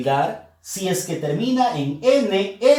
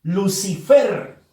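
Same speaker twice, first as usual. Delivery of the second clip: slow "Lucifer", stressed on "fer"; stress on last syllable; 4 dB louder, slower than usual. Shown as a demonstration. Teacher showing where the accent falls, stress on "fer". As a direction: draw out "Lucifer" and hit the final "fer". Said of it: "Fuerza de voz en la sílaba fer".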